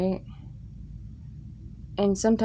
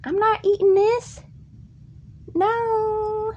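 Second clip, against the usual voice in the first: very high, louder indignant squeal; refusing; 3 dB louder, slower than usual. Retold or imitated. Kid refusing grandma's cauliflower, high loud squeal. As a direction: shriek your refusal. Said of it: "I'm like".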